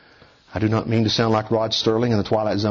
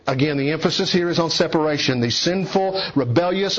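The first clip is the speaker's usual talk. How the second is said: a higher, urgent pulpit delivery; raised preaching voice; 6 semitones higher than usual. Acted. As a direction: preach it out to the congregation.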